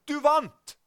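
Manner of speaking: high, ringing cry of victory; triumphant shout. Retold or imitated. Jesus running towards you with the news, shouting. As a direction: call it out loud and very high. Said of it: "Og så roper han".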